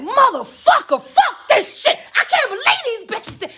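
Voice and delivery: high-pitched, loud outburst of cursing; furiosa perorata recreada. Retold or imitated. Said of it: "I'm going in".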